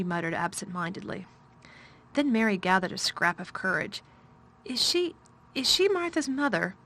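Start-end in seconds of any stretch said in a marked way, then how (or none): 4.6-6.8 put-on a higher, halting child's stammer; stammered question. A narrator's reading. Shy girl asking the master about Martha, stammering. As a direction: stumble on the first words, timid. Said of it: "she stammered".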